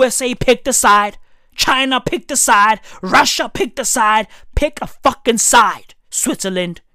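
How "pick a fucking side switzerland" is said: normal talking voice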